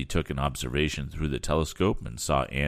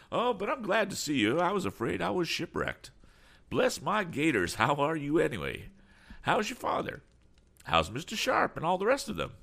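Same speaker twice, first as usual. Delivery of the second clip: high-pitched effusive greeting; glad, excitable eccentric; 14 semitones higher than usual. Acted. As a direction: greet a friend in a fussy high voice. Damon greets Tom.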